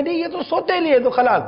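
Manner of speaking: mock dismissive son. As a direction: toss it off like a teen who's made up his mind, laughing. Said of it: "وہ ایک programme اس کا activate ہو گیا کہ". (سوتیلے بیٹے کی ہنستے ہوئے، اونچی آواز میں طنزیہ نقل)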